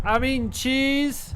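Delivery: high, loud, slow mouse squeal; squeaky mouse voice. Pretend. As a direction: squeal it like a tiny excited mouse. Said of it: "a mouse that was actually squeaking".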